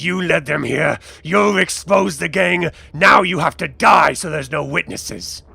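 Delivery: loud, menacing accusation; angry threat. Acted. Gang captor blames hostage for exposing gang, angry threat. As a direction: pass sentence on a traitor.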